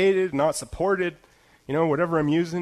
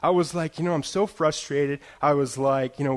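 usual speaking voice

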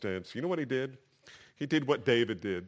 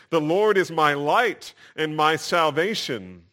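slow, higher, louder proclamation; declaring; 5 semitones higher, 8 dB louder, slower than usual. Retold or imitated. Preacher quotes David's confidence in God, declaring. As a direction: declare it like a creed. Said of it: "And so David declares".

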